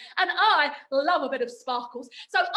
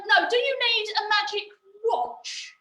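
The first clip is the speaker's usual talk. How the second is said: normal talking voice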